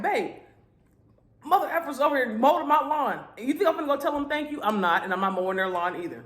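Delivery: indignant rant to wife. Retold or imitated. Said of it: "I'm like".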